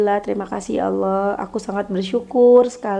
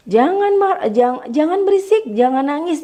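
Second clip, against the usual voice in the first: high raised scold; 6 semitones higher than usual. Shown as a demonstration. Parent scolding crying children, high raised voice. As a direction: scold them to stop crying, pitched up. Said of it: "kalau kita misalnya".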